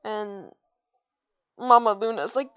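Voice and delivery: ordinary delivery, nothing marked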